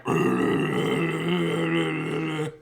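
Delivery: long, loud rolling alien noise; hammed-up trilled creature growl; imitated alien growl. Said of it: "they make, like, growling noises".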